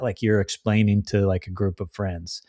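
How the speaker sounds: normal talking voice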